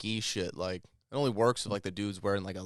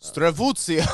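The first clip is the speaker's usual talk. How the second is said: mock Russian gibberish, louder; hammy fake Russian word; Russian accent; 6 semitones higher, 6 dB louder, slower than usual. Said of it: "Can you do, like, a Russian accent?".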